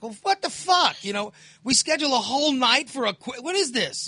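high-pitched exasperated outburst; indignant, voice jumping up in disbelief; pissed off. Retold or imitated. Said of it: "You know, I get pissed off".

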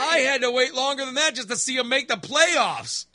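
high, loud mock indignation; indignant protest. Dad answering his daughter's lifelong wait, high indignant protest. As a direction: complain loudly that you had it worse.

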